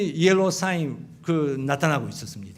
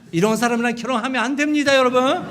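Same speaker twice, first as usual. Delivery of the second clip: high, loud, fast laughing warning; laughing outburst; 7 semitones higher, 5 dB louder, faster than usual. Real, not acted. Pastor laughing to his congregation, a loud high warning. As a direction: blurt it out laughing at himself.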